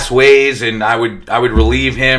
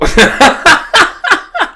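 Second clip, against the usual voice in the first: high rising laugh; real laugh rising high. Real, not acted. Laugh after doctor's remark.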